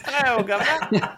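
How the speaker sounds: high, hammed-up British greeting; jokey cockney-style British hello; British accent. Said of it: "British accent".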